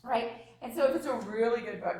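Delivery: usual speaking voice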